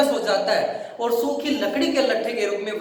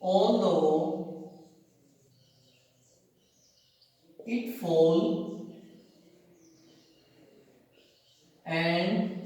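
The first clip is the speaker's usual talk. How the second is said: very slow, softer, word by word; slow dictation; 5 semitones lower, 5 dB quieter, much slower than usual. Shown as a demonstration. Teacher dictates poem words slowly, softly to students. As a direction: pause after each word for writing. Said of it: "teacher dictates poem".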